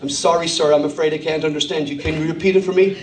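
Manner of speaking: low, apologetic American operator; polite American telephone voice. Acted. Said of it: "change your accent and you were there".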